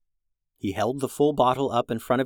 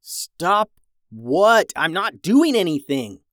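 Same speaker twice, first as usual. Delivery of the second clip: raised, louder protest; exasperated yell; 4 semitones higher, 4 dB louder, slower than usual. A narrator's reading. Reed protests to his brothers, confused yelling. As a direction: yell back, confused and annoyed. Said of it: "Reed yelled".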